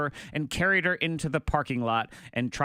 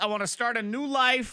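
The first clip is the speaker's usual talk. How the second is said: high-pitched pleading, hammed up; comic fed-up whine; mock-desperate plea; 10 semitones higher than usual. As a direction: play a husband desperate to escape. Acting the fed-up ranch owner's rant.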